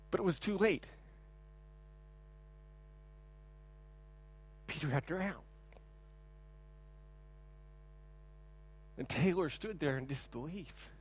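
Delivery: sombre, hushed storytelling; hushed, grave. Real, not acted. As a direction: let the tragedy sink in, quietly. Tragic end of the story.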